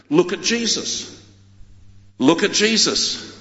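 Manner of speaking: usual speaking voice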